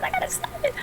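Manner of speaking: very high squeaky complaint; squeaky dismayed whine. Real, not acted. Whining about login.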